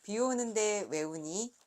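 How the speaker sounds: measured, clearly voiced read-aloud with pauses; picture-book reading. A narrator's reading. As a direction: read each question and answer like a picture book. Reads her picture book aloud. (또박또박 그림책 낭독)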